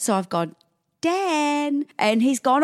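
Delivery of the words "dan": high, drawn-out call of a name; urgent controlled call. Retold or imitated. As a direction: call him over without scaring the child. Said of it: "like this".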